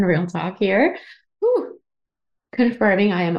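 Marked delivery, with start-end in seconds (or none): 1.4-1.8 relieved whew. Real, not acted. Relief after emotional talk.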